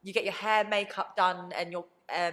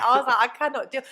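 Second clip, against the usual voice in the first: laughing; 5 semitones higher, 9 dB louder than usual. Retold or imitated.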